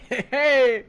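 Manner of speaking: loud, high eager hey; eager greeting. Acted. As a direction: call out eagerly. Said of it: "be like".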